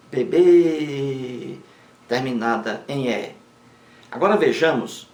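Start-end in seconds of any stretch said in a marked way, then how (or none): 0.1-1.6 "bebê" with a long-held final bê; stress on last syllable. Shown as a demonstration. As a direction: stretch the stressed end of "bebê". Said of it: "Oxítona".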